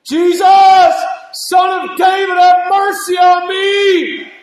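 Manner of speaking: shouted high and loud, slow and pleading; a beggar's full-throated plea for mercy; desperate cry for mercy. Retold or imitated. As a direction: cry out to Jesus with all your might. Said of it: "They cried out with all their might".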